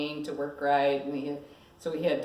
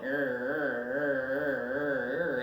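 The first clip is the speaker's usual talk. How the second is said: grinding gear noise; 3 semitones lower, much slower than usual. Retold or imitated. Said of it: "you hear the gears try to go down".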